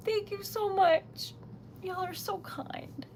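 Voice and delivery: slow, high thanks fading to near-whisper; moved, tearful. Real, not acted. Streamer thanking kind viewers, choked up. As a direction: say thanks slowly, voice thinning away.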